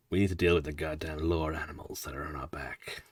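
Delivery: hushed whisper to stay hidden; breathy whisper. Real, not acted. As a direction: whisper it so they don't hear. Whisper measured unvoiced.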